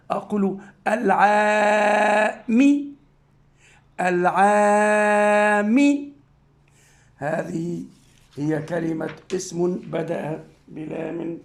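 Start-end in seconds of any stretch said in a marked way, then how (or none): 0.9-5.8 العام with the long a held slowly and louder; long drawn vowel. Shown as a demonstration. Teacher modelling the long vowel in العام for the class. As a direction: stretch the a in العام out. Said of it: "مقطع مد".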